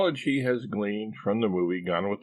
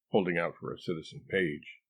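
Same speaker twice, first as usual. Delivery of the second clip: usual speaking voice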